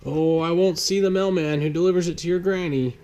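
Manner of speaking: put-on a friendly postman gently setting the boy straight; postman Nevin. Postman Mister Nevin explains.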